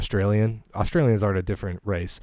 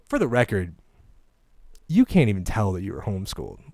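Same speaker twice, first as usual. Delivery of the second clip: ordinary talk, nothing marked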